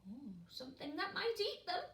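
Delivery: usual speaking voice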